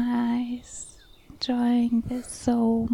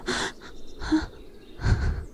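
breathy gasp of pleasure. Acted. Narrator acting out her own reaction to a lover, gasping. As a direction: let out an unvoiced gasp. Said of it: "at which I go".

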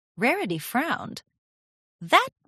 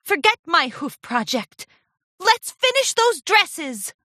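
rally her friend with cheer; bright determined enthusiasm; eager rallying; 10 semitones higher, 4 dB louder than usual. A narrator's reading. Charity grins, rallies Rarity.

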